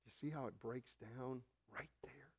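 hushed unvoiced whisper; preacher whispering to the congregation. Real, not acted. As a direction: drop to a grave whisper. Sorrowful aside to congregation.

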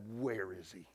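low, very soft and controlled; hushed resolve; quiet intensity. Retold or imitated. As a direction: drop right down to a hushed, grave question. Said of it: "with the anger and with a full commitment to pay".

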